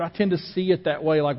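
usual speaking voice

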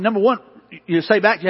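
ordinary talk

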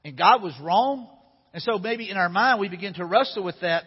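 ordinary delivery

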